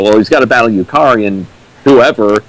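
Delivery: normal talking voice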